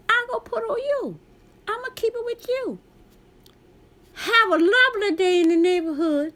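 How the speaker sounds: high, sing-song fake-cheerful voice; singsong fake cheer aimed at the suspicious clerk; acting crazy. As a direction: sing it out in a sugary mock-sweet tone. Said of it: "I'm acting crazy".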